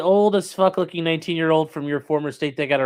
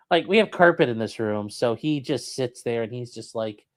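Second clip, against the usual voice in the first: normal talking voice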